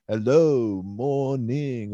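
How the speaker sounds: "Hello, morning" sung out in odd, drawn swoops; playful, exaggerated inflection on "Hello, morning"; different inflection. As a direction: greet a housemate with a silly lilt on "morning". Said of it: "say things with a different inflection".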